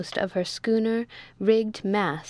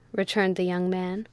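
plain reading voice

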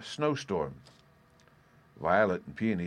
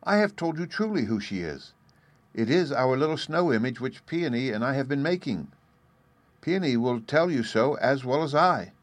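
put-on high, emphatic little girl's voice; earnest, surprised daughter; 5 semitones higher, 3 dB louder than usual. A narrator's reading. Daughter surprised mother needs more explanation, earnestly insisting. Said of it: "apparently surprised that she should need any further explanation".

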